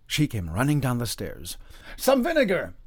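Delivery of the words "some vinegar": high, loud urgent demand; alarmed call for supplies; crying out. A narrator's reading. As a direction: cry for help quickly. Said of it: "he cried".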